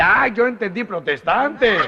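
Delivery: high, loud, slow father voice; father's final line of the joke, played big; hammed-up punchline.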